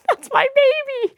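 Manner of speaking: very high cutesy aside; mock hurt baby voice. To her ex about the cat, high cutesy protest. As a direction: squeak it like a doting pet owner.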